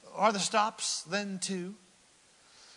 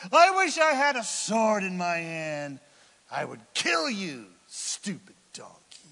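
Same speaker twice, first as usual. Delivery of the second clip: angry threat; 3 semitones higher, 9 dB louder than usual. Acted. Balaam threatening his donkey, loud then dropping. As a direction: rage at the donkey, then sink.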